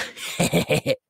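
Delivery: high little clown giggle; creepy, pleased snicker; smug chuckle. Acted. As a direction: titter at your own remark. Amused comparing heights.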